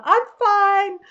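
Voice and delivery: cheerful automatic Australian reply; polite reply. Retold or imitated. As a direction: brush it off politely. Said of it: "You have to politely respond".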